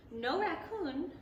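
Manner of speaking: plain reading voice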